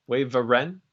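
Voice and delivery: "wyvern" as WAY-vuh-ren, rising as a question; extra added vowel. Retold or imitated. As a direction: put an "uh" before the R in "wyvern". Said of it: "vowel pronunciation".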